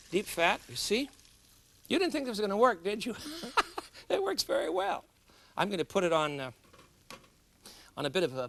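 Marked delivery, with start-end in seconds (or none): 3.2-3.8 a quick, high little laugh; laugh, tickled it worked; high amused laugh. Real, not acted. Cook laughing to viewers at his own success. 4.1-5.0 high, pleased and proud; delighted. Real, not acted. Cook telling viewers the cheese worked, delighted.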